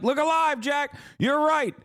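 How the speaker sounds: put-on very high, strained old-man caricature; hammed-up senile president impression; Joe Biden. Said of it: "And then Biden would like".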